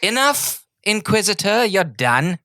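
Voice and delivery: high, loud, rising commanding king; wrong king voice. Acted. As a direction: bark it high and loud, then drop the voice as wrong. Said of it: "No, I'm sorry, that's not his accent".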